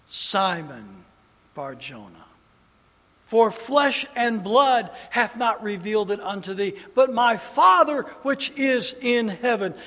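Jesus blessing Simon; proclaimed blessing. Acted. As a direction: pronounce the blessing grandly.

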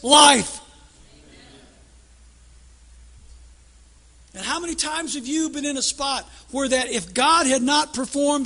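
high, loud, slow preacher's cry; fiery revival shout; preaching at full force. Real, not acted. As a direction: thunder it out to the congregation, slowly. Preacher builds to climax.

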